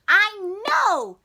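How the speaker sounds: high, loud "I know"; eager kid. Acted. Froggy telling dad he knows, high and loud. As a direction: chirp it back impatiently.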